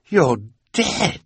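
rising disbelief, You're dead; stunned Olaf. A narrator's reading. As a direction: gape at a ghost. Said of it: "saying something that he knew full well was ridiculous".

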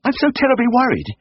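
high-pitched fretting lady; sudden anxious blurt. A narrator's reading. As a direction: blurt out your worst fear in a falsetto lady's voice. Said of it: "then she suddenly blurted out".

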